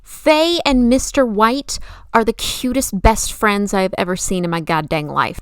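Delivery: ordinary talk, nothing marked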